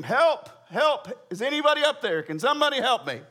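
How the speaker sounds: high, loud storytelling cry; mock scream for help. Man hanging off a cliff calls for rescue, mock scream. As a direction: call up for help in hammed-up alarm. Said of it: "so he began to scream".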